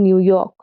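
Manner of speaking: unstressed New, then a stressed York. Shown as a demonstration. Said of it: "an unstressed followed by a stressed syllable".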